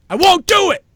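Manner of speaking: defiant shouted repeat; shouting. Real, not acted. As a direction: yell it now, voice climbing. Escalating rant.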